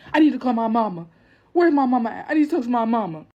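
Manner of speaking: mocking a man crying for mama; whiny mama's boy. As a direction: beg for your mama. Dad whining for mama.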